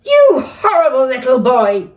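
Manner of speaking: dad scolding in a rage; angry shout. Acted. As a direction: bellow at the boy. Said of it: "screamed Dad".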